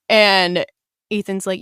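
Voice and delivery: ordinary talk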